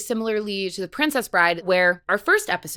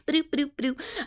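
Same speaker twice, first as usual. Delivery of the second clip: higher, softer plucked-string noises; imitated guitar sound; 5 semitones higher, 6 dB quieter than usual. Shown as a demonstration. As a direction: mimic an instrument being plucked. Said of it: "That's what the sound it makes".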